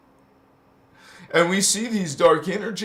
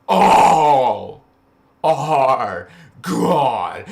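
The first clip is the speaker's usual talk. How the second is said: all cried out, much louder; fervent shout; 3 semitones lower, 10 dB louder, much slower than usual. Real, not acted. Preaching to viewers, crying out all are God. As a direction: belt it like a revival call.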